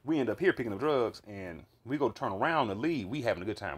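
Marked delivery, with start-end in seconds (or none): none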